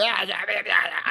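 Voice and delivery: put-on high slurred drunk voice; town drunk. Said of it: "Ahora yo ni puedo hacer la voz de borrachito".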